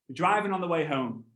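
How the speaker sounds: normal talking voice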